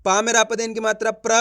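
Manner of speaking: usual speaking voice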